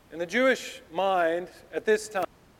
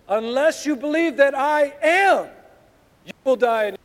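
emphatic reading; 9 semitones higher, 8 dB louder than usual. Shown as a demonstration. Preacher reading the Greek wording his preferred way, emphatic proclamation. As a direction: ring out "I am" long and loud. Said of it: "I'd prefer to read it this way".

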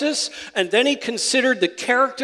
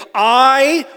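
"I" higher, loud and held long; emphatic I; 4 semitones higher, 7 dB louder than usual. Shown as a demonstration. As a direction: proclaim "I" as if preaching to oneself. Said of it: "almost like he's preaching to himself".

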